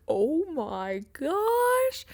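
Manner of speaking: very high, drawn-out "oh my gosh"; shocked reaction. Retold or imitated. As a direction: stretch it out high in mock shock. Retells her own shocked reaction.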